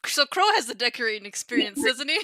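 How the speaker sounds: ordinary talk, nothing marked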